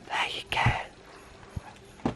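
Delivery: slow, soft unvoiced whisper. Real, not acted. Settling baby in cot.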